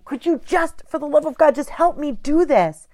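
for the love of God, a fed-up wife's outburst; angry demand. Shown as a demonstration. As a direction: snap it out like you've had enough. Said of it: "you don't wanna come at them with anger".

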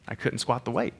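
ordinary talk, nothing marked

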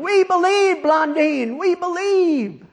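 high, drawn-out mock crowd yell. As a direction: holler it like a fervent crowd. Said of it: "They're all yelling".

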